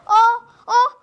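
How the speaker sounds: high, loud panicked shout; frightened cry. Acted. Little frog panics as the river rises at his mother's grave, shouting high. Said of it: "he cried".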